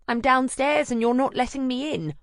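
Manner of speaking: high, loud cheery friend voice; chirpy girlfriend calling up from the street; bright friend Anna. Acted. Anna at the intercom.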